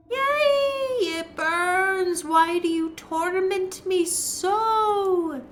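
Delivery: high, slow, wailing ghost woman; wailing wraith. Acted. Wraith shrieking at the intruder, high wailing moan.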